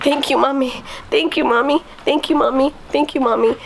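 put-on high, repetitive sing-song child voice; imitated little child. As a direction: repeat it like a little girl in a daze. Child repeatedly thanking mother, retold.

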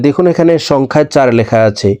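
ordinary talk, nothing marked

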